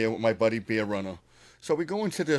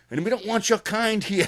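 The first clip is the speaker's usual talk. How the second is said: raised, higher mock yell of the cowboys; a louder retold shout, voice up high; 8 semitones higher, 4 dB louder than usual. Retelling the cowboys yelling at him outside the bar, mock yell. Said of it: "They're yelling".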